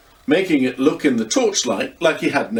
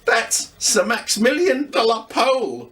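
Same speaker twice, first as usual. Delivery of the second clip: put-on higher, lively young boy voice; boy Luca; 10 semitones higher than usual. A narrator's reading. Luca teases grandad about looking scary, young boy voice. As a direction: play a cheeky young boy teasing grandad. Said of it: "said Luca authoritatively".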